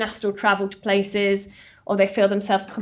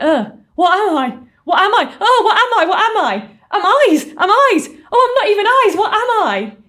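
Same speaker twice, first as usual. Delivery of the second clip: loud, high-pitched frantic cries; reenacting someone's horrified discovery at full voice; acted-out panic; 10 semitones higher, 9 dB louder than usual. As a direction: build to a loud, alarmed wail of confusion. Acting entity's panicked discovery.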